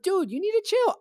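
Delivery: very high-pitched squeal; alarmed protest. Retold or imitated. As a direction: protest in a high, panicked mock voice. Retold protest to masseuse.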